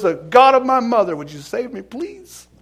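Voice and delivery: acted plea rising high at the end; mock pleading, lifting sharply on the last word; retold begging. John Newton begs God on the ship, acted rising plea. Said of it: "he begged".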